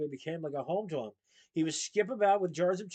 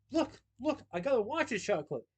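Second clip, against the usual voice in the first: much higher, squealing with delight; a thrilled lady showing off her prize; cry of joy; 7 semitones higher than usual. Acted. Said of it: "cried with joy".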